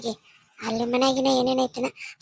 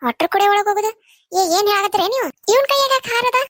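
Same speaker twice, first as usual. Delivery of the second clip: higher-pitched, indignant challenge; indignant questioning; 6 semitones higher, 4 dB louder than usual. Acted. Woman challenging those blocking the family at the door, indignant. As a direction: demand an explanation, voice climbing higher.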